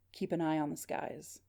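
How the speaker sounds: muted, measured phone voice; weakening voice. Acted. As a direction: say it evenly and faintly. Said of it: "but still kind of weakening out".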